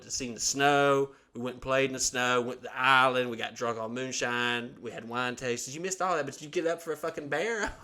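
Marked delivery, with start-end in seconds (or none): none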